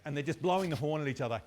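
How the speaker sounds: ordinary talk, nothing marked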